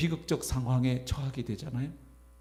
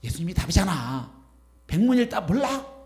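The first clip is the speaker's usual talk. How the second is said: high, loud, smart-aleck taunt; smug and snide; 5 semitones higher, 6 dB louder than usual. Pretend. Preacher mocking a snide believer with a high, loud, smug taunt. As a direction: needle them like a know-it-all. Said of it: "너무 얄밉게".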